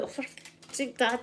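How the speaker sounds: whispered embarrassed curse; mortified at dad in the clinic, whispered curse; exasperated whisper. Retold or imitated. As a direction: hiss it so nobody hears. Exasperated at dad's joke.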